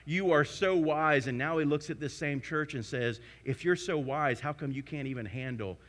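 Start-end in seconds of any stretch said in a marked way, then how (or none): 3.4-5.8 dry mocking question, dropping soft at the end; sarcastic. Preacher voicing Paul mocking the church, sarcastic. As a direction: needle them with sarcasm. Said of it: "You hear the sarcasm?".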